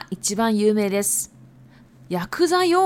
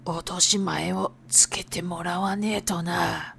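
low, soft gangster threat on 落とし前; 6 semitones lower, 5 dB quieter than usual. Acted. Yakuza example of same phrase.

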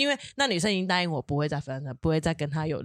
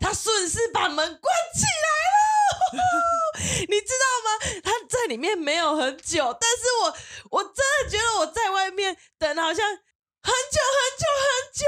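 shrieking laugh. Real, not acted. Telling a friend about the agonising wait, high shrieking laugh. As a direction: squeal it out, half laughing half crying.

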